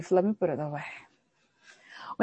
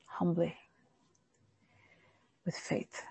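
whisper; 3 semitones lower, 13 dB quieter than usual. Real, not acted. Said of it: "unvoiced closing point".

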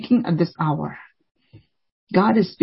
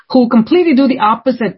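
ordinary talk, nothing marked

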